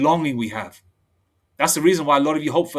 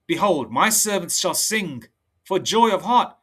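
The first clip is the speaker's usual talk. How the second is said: slow, measured preaching read; solemn preacher reading verse; scripture reading; 5 semitones higher, slower than usual. Acted. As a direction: lay out each line of scripture deliberately. Reads Isaiah aloud slowly.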